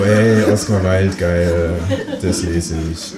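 slow, lightly mocking impressed voice; imitating a reader impressed by the quote. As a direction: play a gullible fan, half laughing.

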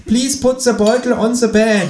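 put-on high, slow German-accented English; bad German English. A narrator's reading. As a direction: colleague attempts English with a thick German accent. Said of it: "mit einer Übersetzung".

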